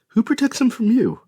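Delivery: high, loud indignant question; indignant outcry. A narrator's reading. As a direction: throw it back at him, outraged. Piper confronts her father.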